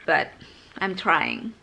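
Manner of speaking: ordinary talk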